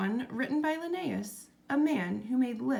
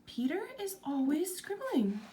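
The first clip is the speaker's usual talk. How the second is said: higher, complaining mother voice; 8 semitones higher than usual. A narrator's reading. Mother grumbling about her son's scribbling, higher. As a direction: sigh it out as a fed-up mother. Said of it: "His mother complained".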